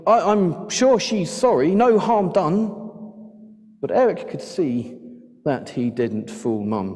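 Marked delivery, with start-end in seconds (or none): 0.1-2.8 high, wheedling excuse with a stumble; blame-shifting excuse. A narrator's reading. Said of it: "shifting the blame".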